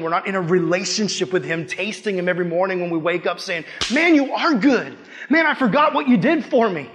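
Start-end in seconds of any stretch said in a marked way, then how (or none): 3.9-6.9 high, loud, laughing delight in God; joyful praise. Acted. A believer waking up praising God, laughing and excited. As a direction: gush with wonder, breaking into a laugh.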